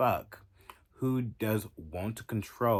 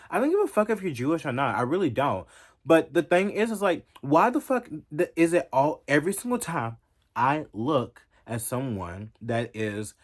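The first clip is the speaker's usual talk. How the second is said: a higher, louder, furious tirade; angry rant; 3 semitones higher, 6 dB louder than usual. Real, not acted. Vlogger ranting at her critics, loud and furious. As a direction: let the anger boil over and yell it.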